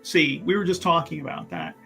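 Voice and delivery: ordinary delivery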